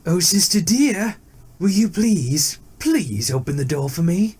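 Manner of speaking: sweeten the tiger's voice to lure her; sweet-talking tiger. A narrator's reading. Tiger coaxing the deer to open the door, high sweet plea. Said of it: "He called out to her".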